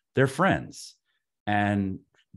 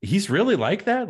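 disbelieving; 11 semitones higher, 4 dB louder than usual. Retold or imitated. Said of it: "and I'm like".

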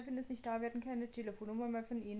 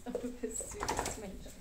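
cooing. Real, not acted. Cooing to dog.

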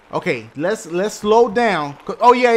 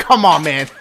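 ordinary delivery

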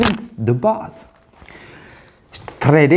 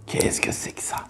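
whisper; 10 dB quieter than usual. Real, not acted. Tasting strange candy, whispering.

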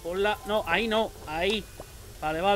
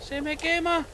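rising panic; 7 semitones higher than usual. Real, not acted. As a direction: panic climbing higher each time. Food burning, repeated cries.

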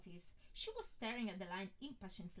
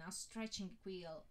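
ordinary delivery

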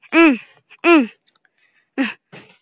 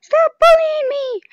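squeaky high pleading; whiny high voice; 13 semitones higher than usual. Acted. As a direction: pitch way up, whine it.

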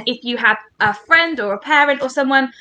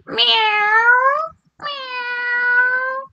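very high, long meows; cat meow; 12 semitones higher than usual. Shown as a demonstration. Leading the children in meowing like a cat, high cat meow. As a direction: mew like a little cat. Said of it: "Can we meow like a cat?".

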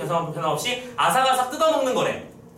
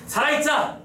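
higher-pitched, acted-out resolve; inner rallying cry; 6 semitones higher than usual. Teacher acting out the hidden wish to survive, rallying cry. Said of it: "라고 하는 속마음".

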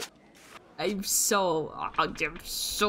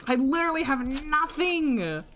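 exasperated; 8 semitones higher than usual. Real, not acted. Bad hand in game.

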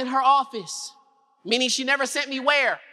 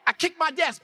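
usual speaking voice

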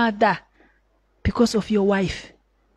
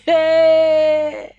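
laughing hey; 4 semitones higher, 4 dB louder than usual. Real, not acted. Laughing exclamation.

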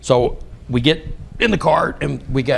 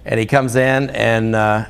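normal talking voice

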